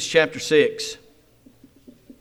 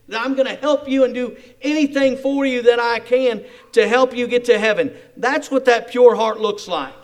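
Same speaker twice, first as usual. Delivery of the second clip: louder, driving preacher's push; full-force preaching; 4 semitones higher, 10 dB louder than usual. Acted. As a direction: preach it out with fervor. Fervent preaching about pure love.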